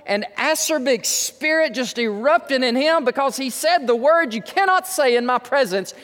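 high, impassioned sermon delivery; ringing, emphatic pulpit voice; forceful preaching. Real, not acted. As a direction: preach it with mounting outrage.

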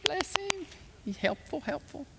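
a hint of sarcastic fawning; mock sweet praise; mock admiring congregation. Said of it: "and you all said".

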